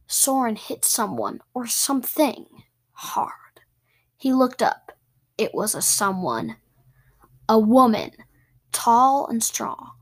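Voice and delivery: plain reading voice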